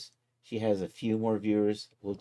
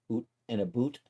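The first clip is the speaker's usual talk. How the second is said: put-on "oot and a boot" in a joking Canadian accent; mock Canadian accent; 3 semitones higher than usual. Streamer teasing his Canadian friends, mock Canadian accent. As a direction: exaggerate the Canadian vowels, "oo" for "ou". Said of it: "our Canadian friends".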